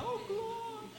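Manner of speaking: high mock "Oh, glória"; hammed-up short praise shout; mock praise cry. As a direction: parody the other believer's cry. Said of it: "O outro tá".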